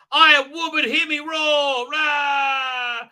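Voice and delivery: high, loud hammed-up roar; sarcastic triumphant holler; mock battle cry. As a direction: proclaim it and finish in a silly roar.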